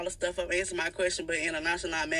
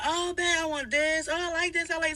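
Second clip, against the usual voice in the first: higher, sugary fake-innocent customer; fake sweet voice; 7 semitones higher than usual. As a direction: gush over it to rub it in. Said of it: "So I'm like".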